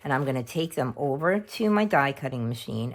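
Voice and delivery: ordinary talk, nothing marked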